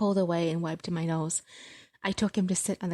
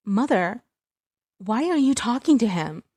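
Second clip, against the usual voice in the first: raised, louder angry demand; 4 semitones higher, 7 dB louder than usual. Acted. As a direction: confront your mother, loud and sharp. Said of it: "anger filled me".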